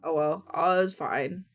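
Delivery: usual speaking voice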